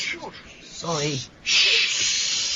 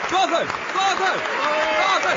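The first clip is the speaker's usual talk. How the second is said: cheering; 4 semitones higher, slower than usual. Real, not acted. Audience member cheers play.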